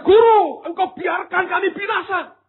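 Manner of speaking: loud desperate cry to the teacher; panicked shout. Acted. Disciples shouting at Jesus in the sinking boat, panicked. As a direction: yell it over the storm in panic. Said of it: "Mereka berkata".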